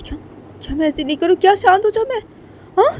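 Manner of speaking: high, loud furious yell; angry mother screaming back at the calm host; furious shrill retort. Acted. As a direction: throw her words back at her in a rage.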